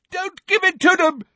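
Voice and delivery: very high, loud desperate roar. A narrator's reading. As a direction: scream it beside himself. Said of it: "roared Neville".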